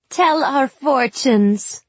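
put-on high, loud, slow drunken demand; tipsy girl demanding. A narrator's reading. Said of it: "the taller girl demanded".

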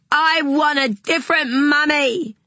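put-on high, loud child's shout; boy shouting at his mother mid-tantrum. A narrator's reading. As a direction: yell it to wound her. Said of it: "shouted Ziggy".

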